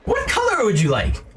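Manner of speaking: a very high, bouncy insect merchant; grasshopper shopkeeper. Acted.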